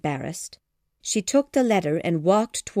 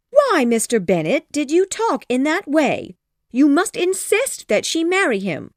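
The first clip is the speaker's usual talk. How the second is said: higher, louder frantic wife; agitated plea; 8 semitones higher, 5 dB louder than usual. Acted. Wife urging husband, high frantic plea. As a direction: beg your husband in a flurry. Mrs Bennet protests.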